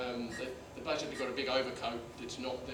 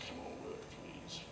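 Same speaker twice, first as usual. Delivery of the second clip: whispered plea, soft; pleading with a machine; 13 dB quieter than usual. Real, not acted. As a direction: beg the video to play. Pleading with failing video.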